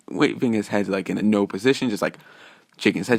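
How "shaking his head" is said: usual speaking voice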